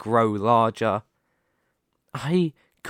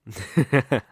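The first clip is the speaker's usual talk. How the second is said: dark chuckle. Acted. Villain laughs at hero's worry.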